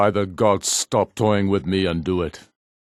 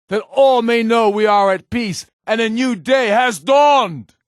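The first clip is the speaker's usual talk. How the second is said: high, loud oration to a crowd; rousing public speech; 11 semitones higher, 4 dB louder than usual. Acted. Ulfric rallies his people in the square, booming oration. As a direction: proclaim it to the gathered people.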